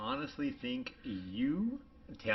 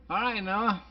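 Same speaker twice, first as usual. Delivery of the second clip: hasty exit; 11 semitones higher, 5 dB louder than usual. Retold or imitated. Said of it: "And I'm like".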